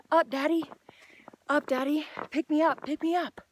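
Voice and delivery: put-on high, pleading little-kid voice; child's plea. Father hearing his small child beg, high and pleading. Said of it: "They say".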